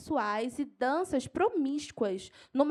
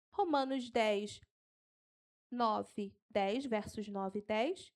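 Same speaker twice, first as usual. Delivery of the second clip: slow, low, softened dictation; unhurried, spaced-out numbers; slow for note-taking; 4 semitones lower, 5 dB quieter, slower than usual. Shown as a demonstration. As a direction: read out the reference slowly with pauses. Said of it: "Eu vou falar devagar".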